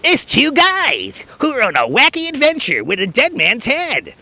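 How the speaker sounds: high, goofy trailer announcer; goofy movie trailer. Acted. Imitating a comedy movie trailer, goofy announcer voice. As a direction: swoop up and down like a wacky trailer voice. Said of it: "movie trailers and stuff".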